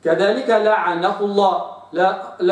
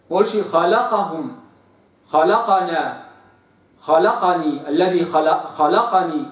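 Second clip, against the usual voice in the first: ordinary delivery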